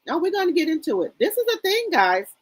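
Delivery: quick, high, eager question; teasing chat into gossip; excited tease. Real, not acted. Streamer hyping her chat for gossip, excited and quick.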